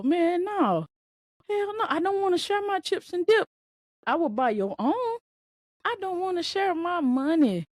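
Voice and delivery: high and whiny, refusing to share; a childish, greedy whine; hammed-up stingy kid refusing to share; mock refusal.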